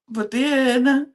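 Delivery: normal talking voice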